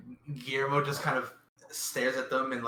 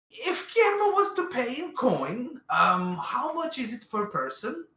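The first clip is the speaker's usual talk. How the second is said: high character voice; 7 semitones higher, 4 dB louder than usual. Acted. Character asks price.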